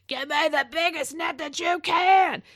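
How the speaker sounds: put-on loud, high, slow, strained kid voice; a little girl reeling in a fish calls loudly for the net; imitating a straining little girl. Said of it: "her voice is deep because she's, I mean, she's pulling".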